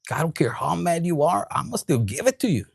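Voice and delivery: ordinary talk, nothing marked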